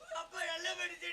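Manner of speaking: high, soft tearful whine; mock crying. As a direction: sob softly in fake mourning. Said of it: "இப்ப நீ ஏன் அழுகுற?". (மெல்லப் போலியாக அழுதபடி)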